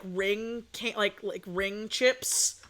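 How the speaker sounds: usual speaking voice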